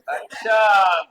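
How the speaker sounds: loud, drawn-out "oh, I see"; mock realisation. Real, not acted. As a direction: exclaim it long and loud, as if it just dawned. Mock realisation at laughing student.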